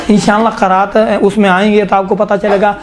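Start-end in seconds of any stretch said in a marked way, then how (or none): none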